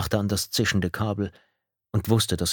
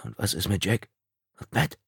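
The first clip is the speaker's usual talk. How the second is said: subdued, trembling question; mildly shaken, hesitant asking; 3 dB quieter than usual. A narrator's reading. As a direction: ask with a touch of dread. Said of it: "Seine Stimme zitterte".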